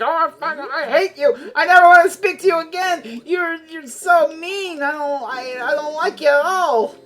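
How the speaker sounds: hammed-up fury of a jilted lover; furious girlfriend. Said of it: "is furious at Pee Wee Herman".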